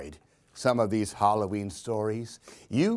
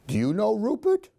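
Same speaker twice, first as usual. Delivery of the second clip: plain reading voice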